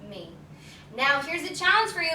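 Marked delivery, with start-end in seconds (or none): 0.1-0.3 slow, softer and lower; peaceful whispered-down affirmation; soft calm mantra. Shown as a demonstration. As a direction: breathe it out gently like a mantra. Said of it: "just by saying this".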